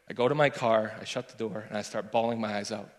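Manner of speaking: ordinary talk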